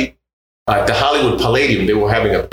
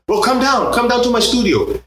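Jorge quote, higher; 12 semitones higher than usual. Retold or imitated. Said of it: "he's like".

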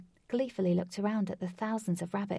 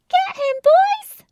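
much higher, loud slow scream; high-pitched scream; 16 dB louder, slower than usual. Acted. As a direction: screech a battle cry. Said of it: "screamed a high-pitched voice".

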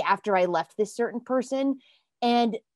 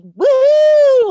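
high, loud whoop of release; a sudden shouted woo of relief; excited whoop; 13 dB louder than usual. Real, not acted. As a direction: let out a big joyful woo.

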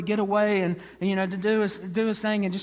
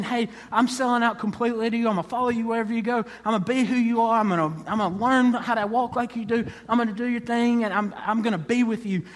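higher, eager run of promises; imitating an overeager disciple; excited follower; 4 semitones higher than usual. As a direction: pile up pledges enthusiastically. Mimics the excited follower's pledges.

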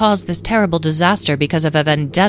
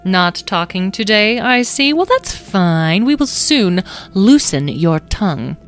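slow, louder, menacing fairy; silky menace; 4 semitones higher, 5 dB louder, slower than usual. A narrator's reading. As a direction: savor the threat. Wisteria threatens the prisoner.

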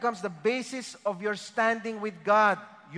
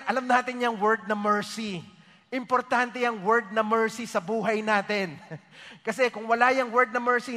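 ordinary talk

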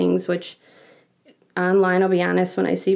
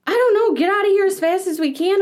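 high-pitched imitation of a panicked husband; put-on urgency, voice jumping up; mock frantic reply; 11 semitones higher, 3 dB louder than usual. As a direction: urge escape in hammed-up alarm.

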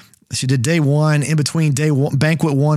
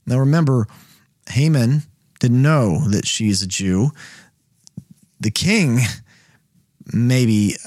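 ordinary talk, nothing marked

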